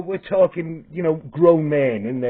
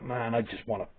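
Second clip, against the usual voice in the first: inner thought; 5 semitones lower, 13 dB quieter than usual. Retold or imitated. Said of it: "and I just thought".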